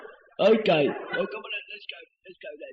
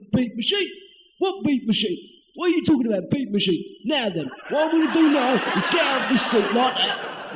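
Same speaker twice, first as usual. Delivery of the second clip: ordinary delivery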